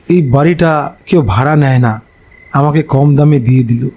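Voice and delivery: usual speaking voice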